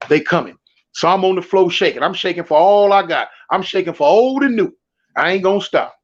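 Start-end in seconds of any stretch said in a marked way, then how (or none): none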